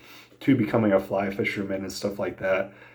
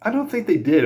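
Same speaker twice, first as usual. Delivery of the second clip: higher-pitched mock yell back to a friend; retold yell; 10 semitones higher than usual. Yelling back to his buddy across the river, mild mock yell. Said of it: "me yelling back to him".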